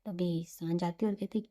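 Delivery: ordinary delivery, nothing marked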